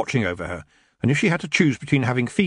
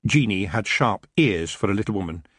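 usual speaking voice

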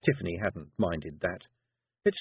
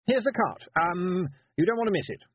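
put-on higher, louder awkward boy; flustered and blushing; nervous boy; 10 semitones higher, 3 dB louder than usual.

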